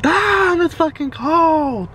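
high, startled and slow; shocked by cold. Real, not acted. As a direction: yelp at the cold. Reacts to cold ice.

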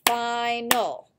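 "Final" in two drawn-out beats, fi-nal; clapped-out syllables. Shown as a demonstration. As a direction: sound out both syllables of "Final". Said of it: "We can clap them out".

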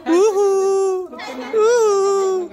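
very high cooing. Real, not acted. Cooing at the baby.

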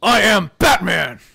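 vigilante vow building to a shout; over-the-top dark hero proclamation; Batman. Acted. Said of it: "That's too much".

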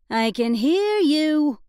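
high, slow sing-song lilt. Acted. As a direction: sing it out teasingly. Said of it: "in a sing-song voice".

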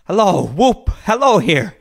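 high, loud hallooing; exultant, high-pitched calling out; joyful whooping. Acted. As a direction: whoop and holler with glee. Scrooge whooping with joy.

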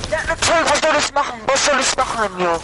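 loud, high furious yelling; angry shout. Real, not acted. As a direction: yell it out in frustration. Raging after being knocked out.

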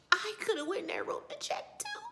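high, breaking whisper of put-on grief; fake tears. June comforting Joseph with fake tears, tearfully whispered. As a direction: act tearfully guilty, voice giving out. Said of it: "she does those fake tears".

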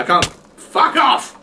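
frustrated shout, slow and high; angry yell. Real, not acted. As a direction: snap it out at the attacker. Shot by skeleton.

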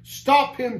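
high, loud order; captain's cry. A narrator's reading. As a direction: cry out an alarm to your men. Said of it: "cried the captain".

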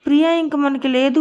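ordinary delivery, nothing marked

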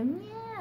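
tiny, very high newborn mewl; mock baby whimper, high and small; imitated faint baby cry. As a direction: imitate a newborn's first weak little cry. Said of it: "así, pero súper despacito".

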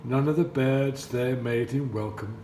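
usual speaking voice

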